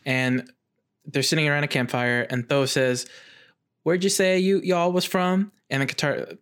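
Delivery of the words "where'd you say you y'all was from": higher-pitched attempt at a Southern US drawl; botched Southern accent. Podcast host voices swamp man Tho, botched Southern accent. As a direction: put on a wrong Southern accent. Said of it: "it's completely wrong accent".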